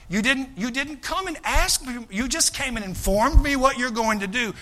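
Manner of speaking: usual speaking voice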